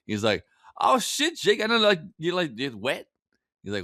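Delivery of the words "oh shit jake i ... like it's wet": high-pitched teasing movie-cop impression; Denzel Washington. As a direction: wind Jake up in a high, alarmed voice. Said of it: "Denzel just loving it".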